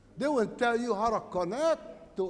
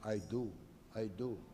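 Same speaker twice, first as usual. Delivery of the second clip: much softer, a firm low I do; quiet affirmation; 9 semitones lower, 12 dB quieter than usual. Acted. Telling listeners how to affirm themselves, softly. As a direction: hammer it home under the breath. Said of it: "you gotta hammer all that and say".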